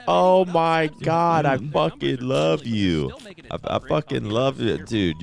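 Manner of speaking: high, drawn-out starstruck gushing; drunk excited fan. Retold or imitated. Drunk fan declares his love to a streamer, imitated high gushing. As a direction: gush slowly like a tipsy superfan. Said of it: "he was fucked up".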